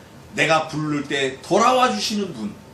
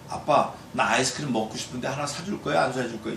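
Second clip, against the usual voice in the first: put-on timid child asking; 4 semitones lower, 8 dB quieter than usual. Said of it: "이게 아니라".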